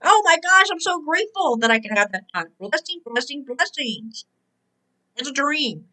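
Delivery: ordinary talk, nothing marked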